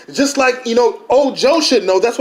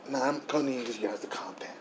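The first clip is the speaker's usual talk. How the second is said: whispered request; 11 semitones lower, 19 dB quieter than usual. Acted. Hushing the noisy guests.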